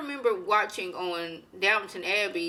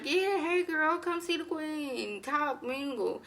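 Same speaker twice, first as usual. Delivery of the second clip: mock sweet invite; 8 semitones higher, 4 dB quieter, slower than usual. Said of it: "It's like".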